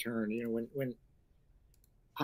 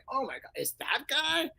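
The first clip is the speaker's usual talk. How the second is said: surprised; 11 semitones higher than usual. Retold or imitated. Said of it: "the audience is going".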